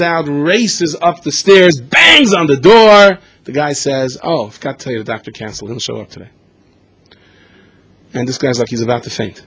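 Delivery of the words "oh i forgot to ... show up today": casual, much softer, dropping away; offhand quiet news. Retold or imitated. Receptionist casually telling the father the doctor canceled, softly offhand. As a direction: toss it off quietly like it's nothing.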